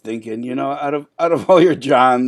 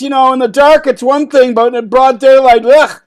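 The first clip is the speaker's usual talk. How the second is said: loud flustered; 9 semitones higher, 8 dB louder than usual. Acted. Embarrassed, caught in broad daylight.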